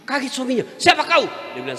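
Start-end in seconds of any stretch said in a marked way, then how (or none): 0.7-1.2 shouted high and loud in fright; startled shout. Retold or imitated. Husband startled by jinn from well, shouting. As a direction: yell it as if something leapt out at you.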